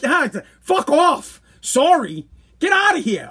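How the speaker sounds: high, exasperated retold shout; angry dismissal. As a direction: throw the apology back with disgust. Retold angry brush-off, "so mad.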